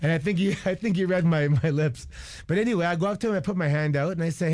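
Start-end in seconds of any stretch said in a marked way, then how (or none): none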